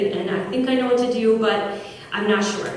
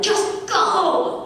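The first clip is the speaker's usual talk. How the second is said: high-pitched irritated outburst; retold angry dismissal; angry mock yell; 10 semitones higher than usual. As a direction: wave someone off angrily, pitched way up. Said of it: "one of these angry".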